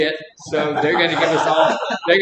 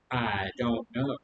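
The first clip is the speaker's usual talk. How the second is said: imitating a tearful wife, much softer and lower, slow; a hint of tears, barely voiced; quiet weepy shrug; 5 semitones lower, 9 dB quieter, slower than usual. Wife crying answers husband's question, softer and lower, mildly weepy.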